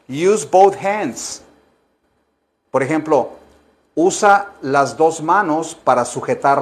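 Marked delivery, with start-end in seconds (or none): 0.1-1.4 "Use both hands" muy despacio y marcado; dictado lento. Shown as a demonstration. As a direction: modela cada palabra. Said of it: "lección de inglés dictada". (dictado muy lento)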